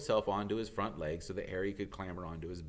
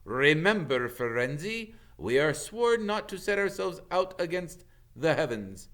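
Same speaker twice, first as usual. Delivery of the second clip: louder, higher stern warning; angry warning; 8 semitones higher, 5 dB louder than usual. Acted. Bane warning Firenze, louder and higher. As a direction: remind him of his oath with menace. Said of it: "growled Bane".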